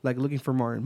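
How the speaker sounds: ordinary delivery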